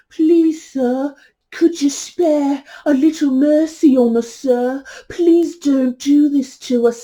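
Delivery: put-on high, drawn-out English accent, pleading like a movie beggar. Beggar begging sir for mercy, pleading English accent. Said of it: "I'm putting on this English accent".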